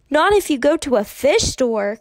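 put-on indignant little girl shouting; bellowing. A narrator's reading. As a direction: yell it out in childish outrage. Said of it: "Kirsty bellowed".

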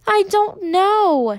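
high, drawn-out tearful wail; acted child bursting into tears; voice choked. A narrator's reading. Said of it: "her voice choked".

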